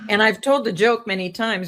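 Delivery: normal talking voice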